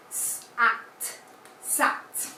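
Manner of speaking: whispered "s-", "at", then "sat"; sounding out words. Shown as a demonstration. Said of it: "For instance".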